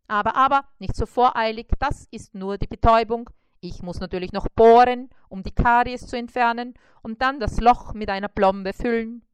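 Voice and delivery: a hint of a brisk, jolly dentist. Mosquito dentist greets and examines the spider, lightly voiced.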